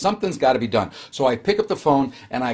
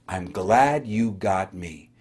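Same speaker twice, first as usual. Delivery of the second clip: slow, lower, tender reassurance; warm and glad; 8 semitones lower, 4 dB quieter, much slower than usual. Retold or imitated. The helper reassuring a sick caller, slow and warm. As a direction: soothe a sick caller gently. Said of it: "that person that said".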